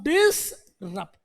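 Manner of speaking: "डिसरप्ट" said very slowly, each sound drawn out; slow careful word. Shown as a demonstration. Teacher modelling the word for a student, slowly. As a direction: stretch "डिसरप्ट" syllable by syllable. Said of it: "इतना समझा रहा हूं".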